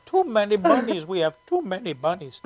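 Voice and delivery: deadpan imitation of an Indian accent; friendly Indian accent. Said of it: "I love doing that accent".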